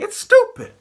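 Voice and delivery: shrieked high, then loud shouting; high-pitched, loud, indignant outburst; exasperated rebuke. Real, not acted. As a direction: shout it at them in outrage.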